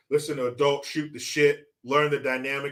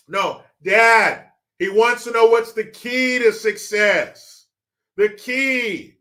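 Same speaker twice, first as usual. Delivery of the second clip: loud, high, slow and emphatic; raised voice for Dad; 6 semitones higher, 6 dB louder, slower than usual. Acted. Son repeats the question to his confused dad, exasperated and loud. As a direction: spell it out for someone hard of hearing.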